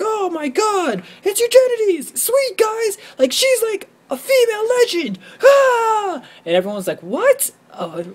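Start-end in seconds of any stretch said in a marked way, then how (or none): 0.0-6.2 very high, squealing starstruck fanboy. Retold or imitated. Gamer spotting a YouTube celebrity in the lobby, very high excited squeal. 7.0-7.5 high, puzzled what; baffled what. Retold or imitated. Lobby players confused by his outburst, high puzzled what.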